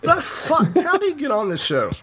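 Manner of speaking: high, laughing incredulity; laughing disbelief. Real, not acted. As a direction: crack up in disbelief.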